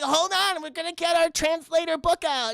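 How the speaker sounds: high, chirpy airhead voice; mock ditzy girls. Mocking the girls fetching the phrase book, high chirpy. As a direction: say it like a clueless tourist. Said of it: "And they're like".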